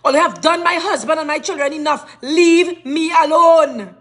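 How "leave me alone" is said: slow, emphatic leave me alone; slow fed-up demand; firm demand. Real, not acted. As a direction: drag out each word, fed up. Angry plea to be left.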